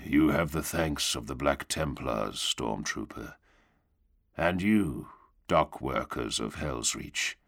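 solemn low drawl, sinking at phrase ends; low, bleak, grim drawl. A narrator's reading. Knight thanking the dock workers, low grim drawl. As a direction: intone it grimly, like a sermon. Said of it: "a low and bleak and grim drawl".

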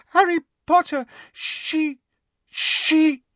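terrified house elf squeaking his master's name. Acted. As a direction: squeak it like a frightened little elf. Dobby voice held.

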